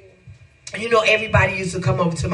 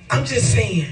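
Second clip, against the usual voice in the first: ordinary talk, nothing marked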